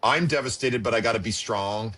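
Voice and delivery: usual speaking voice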